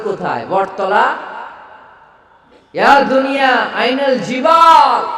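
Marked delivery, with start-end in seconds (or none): 2.7-5.1 higher, louder, slow shouted call; booming call. A narrator's reading. Preacher voicing God calling to the mountains, booming shout. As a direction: thunder the question slowly.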